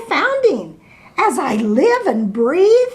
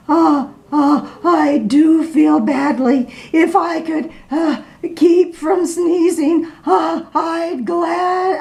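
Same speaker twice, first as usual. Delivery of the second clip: sad elephant; 6 semitones higher than usual. Acted. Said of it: "said the elephant sadly".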